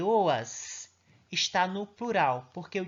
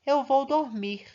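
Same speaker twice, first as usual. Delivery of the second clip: affirming statement; 8 semitones higher, 6 dB louder, slower than usual. Shown as a demonstration. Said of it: "Está afirmando".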